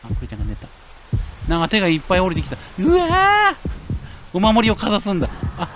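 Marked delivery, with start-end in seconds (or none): 2.8-3.6 sudden high yelp of alarm; startled yell. Real, not acted. 画面の手に驚いて叫ぶ. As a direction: jump at the hands dropping in. Hands descend suddenly in game.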